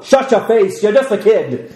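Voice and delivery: high, mocking bully kid; bratty older kid. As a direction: sneer at the little one. Acting older kid rebuffing.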